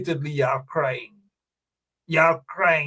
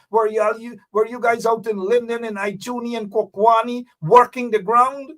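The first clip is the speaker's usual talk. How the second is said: ordinary delivery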